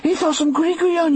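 high, loud Cajun voice; frightened Cajun man, pitched high; Cajun accent. A narrator's reading. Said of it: "spoken in that Cajun accent of his".

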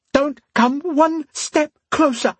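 high slow terrified screech. A narrator's reading. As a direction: screech it in terror. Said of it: "she screeched in a terrified voice".

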